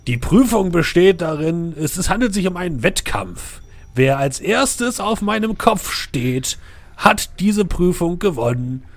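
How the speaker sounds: loud, high-pitched giant announcing; booming knight voice. Acted. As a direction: proclaim the rules from above. The knight explains the trial.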